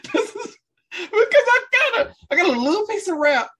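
high, squeezed words through laughter; cracking up. Real, not acted. Co-host explains the little bit of rap, laughing. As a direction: laugh helplessly while explaining.

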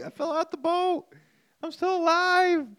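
mock his friend's high yelp; imitating buddy. Adam filming himself after falling overboard, squeaky and high.

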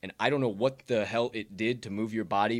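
ordinary talk, nothing marked

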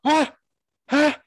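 high, loud barking fighter yells; mock barking kia. Imitating her barking while raining punches. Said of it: "It's like".

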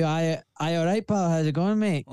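broad working-class Glasgow greeting; working class Glaswegian accent. Shown as a demonstration. Surgeon showing the host his rougher Scottish side, working class Glaswegian accent. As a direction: put on a chummy Glaswegian accent. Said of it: "That's a working class Glaswegian accent".

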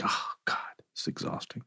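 faint, drained whisper; exhausted whisper. Retold or imitated. Worn-out person sighing to themselves, whispering. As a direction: barely breathe the words out, spent.

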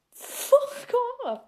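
a sudden high, sharp "fuck off"; high-pitched dismissive snap; defiant retort. Retold or imitated. Retorting to people who call her fat.

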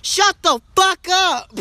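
put-on loud, high bratty kid yell.

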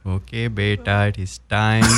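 put-on Indian parent calling the child to dinner; Indian accent. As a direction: play a dad with a standard Indian accent. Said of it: "Your standard Indian accent".

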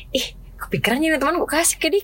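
high, pleasantly surprised squeal; be touched by a friend's thoughtful gift; delighted surprise. Retold or imitated. On receiving a gift from a friend, high delighted surprise.

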